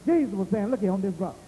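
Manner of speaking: high, drawn-out proclamation; slow, emphatic pulpit cry; preaching. Acted. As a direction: preach it high, stretching the big phrases. Preaches at full force.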